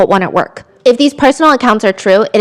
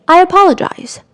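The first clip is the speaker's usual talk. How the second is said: imitating teacher; 9 semitones higher than usual. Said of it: "Don't say, 'I'm sorry.' Say".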